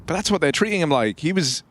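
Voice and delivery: hyped caster, half-sung lines at the end; live play-by-play. Acted. Caster closes the half for viewers, sing-song play-by-play. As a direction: drive it home, sing-song the closing call.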